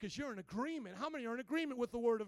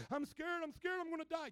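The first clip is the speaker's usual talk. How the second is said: higher, lightly panicked imitation; a touch of mock panic, pitched up; mock freaking out; 6 semitones higher than usual. Preacher imitating someone talking themselves into fear, mildly panicky. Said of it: "you can freak yourself out".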